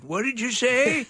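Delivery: put-on high, slow, deaf old man. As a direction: play a deaf old man asking again. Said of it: "that old man voice".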